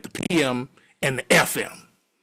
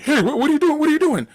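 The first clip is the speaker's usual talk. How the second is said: high, rapid-fire imitation of protest; alarmed protest; 11 semitones higher, 4 dB louder, faster than usual. Friend watching his stuff get thrown, alarmed protest. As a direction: yelp it in mock alarm.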